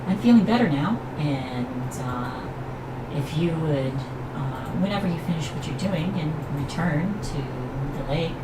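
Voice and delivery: high, light forest spirit voice; dryad voice. Acted. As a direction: speak as a gentle dryad through a bird. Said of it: "then gets to speak".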